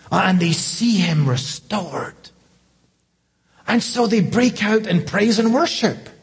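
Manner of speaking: pitched high, building preacher's fervor; high, animated pulpit storytelling; rising excited preaching. Acted. Excited preaching about the crowds.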